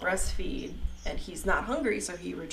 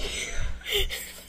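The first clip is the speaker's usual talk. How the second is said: high laugh; 14 semitones higher than usual. Real, not acted. Laughing at her own scare while retelling, bright laughter.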